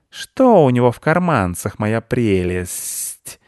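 coveting, with a long drawn-out final word; mock treasure-obsessed creature; creepy covetous creature. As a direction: search greedily, stretching the endearment slowly. Squirrel parodies a creature hunting pockets.

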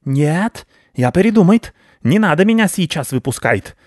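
high, urgent rat voice; nervous rodent protest; panicky rat backing out. Acted. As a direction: hastily take it back as a cornered rat. Rat panics facing the cat.

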